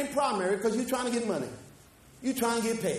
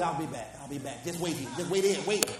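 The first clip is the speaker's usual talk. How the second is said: mock pleading to suits; 5 semitones lower than usual. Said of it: "I'm telling those forties".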